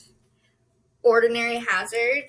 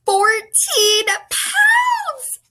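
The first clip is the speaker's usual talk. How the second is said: much higher, louder, very slow, laughing through tears; laughing, teary joy; 11 semitones higher, 7 dB louder, much slower than usual. Real, not acted. Vlogger announcing fourteen pounds lost, laughing and tearful. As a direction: squeal the number out with joy. Announcing her weight loss.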